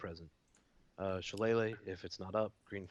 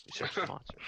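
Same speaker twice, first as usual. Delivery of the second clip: loud; 10 semitones higher, 5 dB louder than usual. Acted. Player echoes the GM's phrase.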